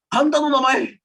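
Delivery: loud, blunt, pushy demand for a name; rude blunt asking. Shown as a demonstration. Teacher demonstrating the rude way to ask a name, loud and blunt. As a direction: fling the question rudely. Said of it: "lebih agak kasar gitu ya".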